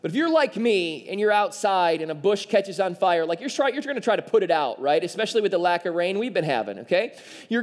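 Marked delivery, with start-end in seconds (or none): none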